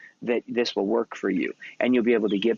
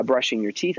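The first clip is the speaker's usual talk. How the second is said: ordinary delivery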